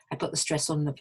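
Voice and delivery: ordinary talk, nothing marked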